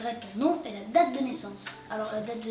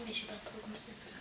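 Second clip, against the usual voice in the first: whispered aside; 2 semitones lower, 11 dB quieter, much faster than usual. Real, not acted.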